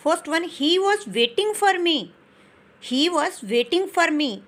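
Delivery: very slow, each word spaced out; slow English example sentence, word by word; slow dictation. Shown as a demonstration. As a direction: read it out slowly for the class to copy. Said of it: "next इसकी examples हैं".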